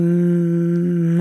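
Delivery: held closed-lip "mm"; imitated baby hum. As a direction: hum a long, level "mm" like a baby. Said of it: "And I kept on going".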